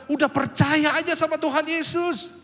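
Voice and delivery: loud, high, drawn-out preaching cry; forceful exhortation. Retold or imitated. Preacher urges others to believe while doubting, forceful exhortation. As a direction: belt it out slowly as if fully convinced.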